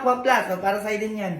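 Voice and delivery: ordinary talk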